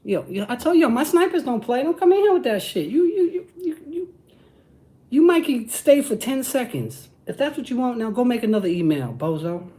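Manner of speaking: getting hype, voice climbing high; hyped up. Real, not acted. Hyped up at chat heckler.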